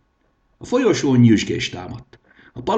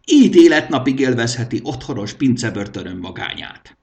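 smug king; 4 semitones higher, 6 dB louder than usual. A narrator's reading. King voiced in story.

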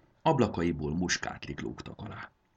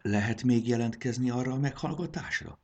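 timid question; 7 semitones higher than usual. Acted. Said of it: "szólalt meg Sári bátortalanul".

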